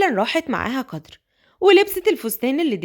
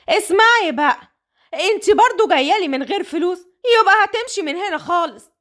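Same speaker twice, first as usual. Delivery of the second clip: mock scolding; 5 semitones higher than usual. Madam threatening Sosan for bringing no money, scolding. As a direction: berate her for no money.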